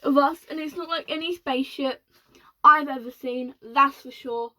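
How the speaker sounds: breathless astronaut report; excited astronaut. Acted. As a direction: report it in wonder.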